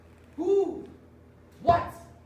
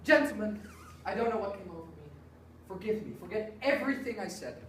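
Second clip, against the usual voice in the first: composed apology; 7 semitones lower, 5 dB quieter, much faster than usual. Acted.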